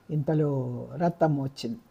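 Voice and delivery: plain reading voice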